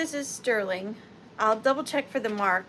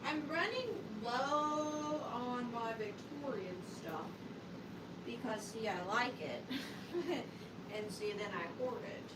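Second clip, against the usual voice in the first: muttering to herself; 14 dB quieter than usual. Real, not acted. Mumbling to herself while browsing.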